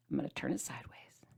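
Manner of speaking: hushed, secretive whisper; teasing whisper. Acted. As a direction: whisper like sharing a secret with viewers.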